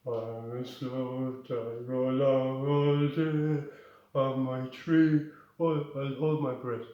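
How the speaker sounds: very slow, dragged-out lazy voice; mocking sloth. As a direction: drawl it like a dopey sloth. Dolphin mocks the sloth.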